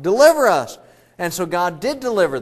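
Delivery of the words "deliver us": louder, higher plea; loud desperate plea of the enslaved people; crying out. Retold or imitated. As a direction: call out for rescue. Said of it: "They cried out".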